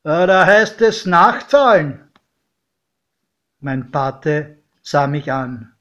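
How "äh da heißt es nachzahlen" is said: loud, raised official demand; doorkeeper calling out. A narrator's reading. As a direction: call it out like a ticket checker catching someone. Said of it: "nahm die Papierschnitzel aus der Hand und rief". (laut und fordernd gerufen)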